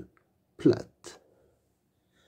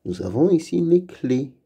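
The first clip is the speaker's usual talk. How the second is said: usual speaking voice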